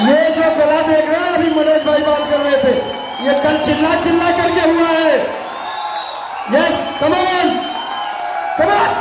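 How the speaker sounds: high, pushed voice over a cheering crowd; rousing shout. Real, not acted. Trainer urging on a clapping hall, rousing shout. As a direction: keep the crowd's energy up.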